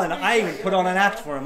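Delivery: ordinary delivery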